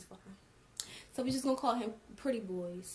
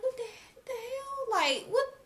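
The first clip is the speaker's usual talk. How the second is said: high-pitched, incredulous retold reaction; stunned, voice leaping way up; shocked; 4 dB louder than usual. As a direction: squeal it in acted disbelief. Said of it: "So I'm shocked. I'm in shock".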